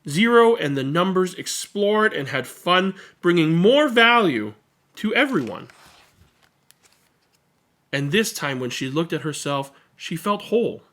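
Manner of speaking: put-on lively read-aloud for children; expressive picture-book reading; story narration. A narrator's reading. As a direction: read it to a room of kids, bright and animated.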